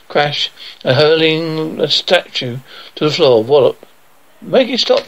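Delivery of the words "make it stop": high-pitched storybook cry; mild shout. A narrator's reading. Alfred pleading for the robot to stop, mild high shout. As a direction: call it out high, without much volume. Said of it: "shouted Alfred".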